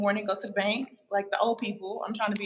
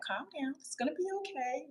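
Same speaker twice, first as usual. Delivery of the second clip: soft imitation of daughter; 7 semitones higher, 8 dB quieter than usual. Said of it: "she's like".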